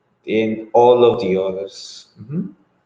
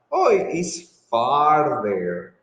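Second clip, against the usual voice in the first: ordinary talk, nothing marked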